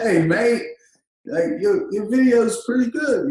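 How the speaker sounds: imitating a beery Australian client praising the video; Australian accent. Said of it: "he's got this accent".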